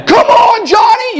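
high shouted cheer from behind the bench; mock gym yell. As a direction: cheer him on at the top of your voice. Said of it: "his friend's yelling at him".

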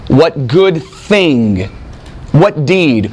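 "What good thing", "What deed" drawn out slowly, word by word; slow, weighty stress on "good thing" and "deed"; emphasis on the deed. Shown as a demonstration. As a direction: land hard on "good thing", then "deed", with deliberate spacing. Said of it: "Notice his emphasis".

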